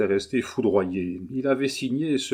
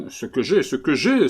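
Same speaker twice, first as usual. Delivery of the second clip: rising in exasperation, a bit louder each time; exasperated outcry; 5 semitones higher, 5 dB louder than usual. A narrator's reading. Collard cries out at Leven, growing exasperated. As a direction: repeat it, getting more worked up. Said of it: "s'écriait monsieur Collard, s'exaspérant".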